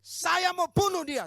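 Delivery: high, slow, drawn-out shout; retold death-spirit threat. Death spirit threatening through a woman, retold shouted threat. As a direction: roar the threat as the imitated spirit. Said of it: "Begitu roh kematian".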